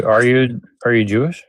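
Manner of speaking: normal talking voice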